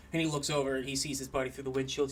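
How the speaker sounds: ordinary delivery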